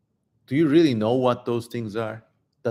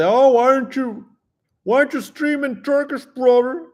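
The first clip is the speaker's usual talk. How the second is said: high, much louder mock-whiny complaint; mock Turkish viewer; 12 semitones higher, 5 dB louder than usual. Streamer mimics Turkish fans asking why he doesn't stream in Turkish, high mocking whine. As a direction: ham up a nagging fan demanding more. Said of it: "these Turkish people say".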